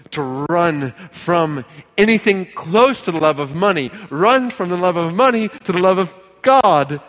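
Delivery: loud, high, climbing exhortation; full-force preaching. Real, not acted. Pastor urges church to be content in God, full-force preaching. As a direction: cry it out to the whole church.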